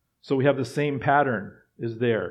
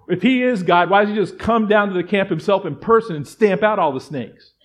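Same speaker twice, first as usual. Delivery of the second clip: louder and higher, driving each question home; a rising pulpit fervor, louder and higher; fervent preaching; 5 semitones higher, 6 dB louder than usual. Acted. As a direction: preach it hard to the congregation. Preaching at full force.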